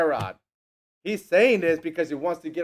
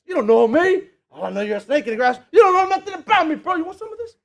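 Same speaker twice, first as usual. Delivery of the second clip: high, loud "you want some of this" taunting; mock street challenge; 11 semitones higher, 6 dB louder than usual. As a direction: puff up like you're ready to fight. Acted street confrontation.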